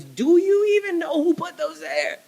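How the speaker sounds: high, incredulous challenge; God asking the doubter, high and incredulous; God's voice. Retold or imitated. God challenging the doubter, high and incredulous. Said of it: "And God goes".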